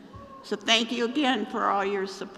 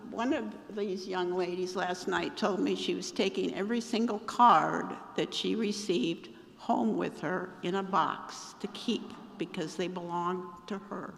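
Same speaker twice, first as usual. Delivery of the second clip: usual speaking voice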